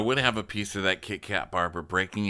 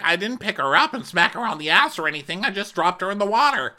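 high, louder defensive voice; mock Eddie; 11 semitones higher, 6 dB louder, faster than usual. Eddie defending himself to his girlfriend, high mocking voice. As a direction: overplay his innocence, high.

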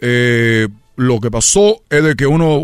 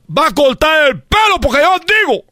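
high shouted command; hammed-up angry yell; father's order; 12 semitones higher than usual. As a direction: bark the order at the son.

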